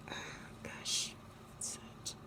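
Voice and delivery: hushed aside to herself; under-the-breath wonder; whisper. Real, not acted. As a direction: whisper it, distracted by something. Aside looking at something.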